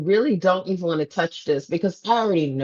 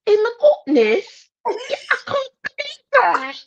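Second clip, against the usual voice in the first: high-pitched put-on English accent; mock a friend's sudden English accent; 12 semitones higher than usual. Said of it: "when I went to England".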